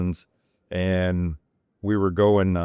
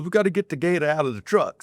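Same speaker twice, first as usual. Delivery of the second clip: imitating cousin; 9 semitones higher than usual. Cousin voiced while laughing.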